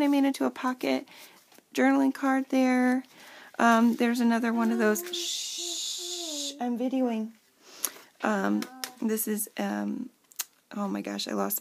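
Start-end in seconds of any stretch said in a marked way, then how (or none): 5.1-6.5 a quick soft hushing shh; soft shush. Real, not acted. Mom hushes her child while videoing, soft shh.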